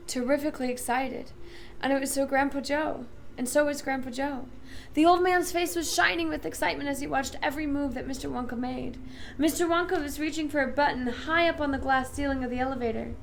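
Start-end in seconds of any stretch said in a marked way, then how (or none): none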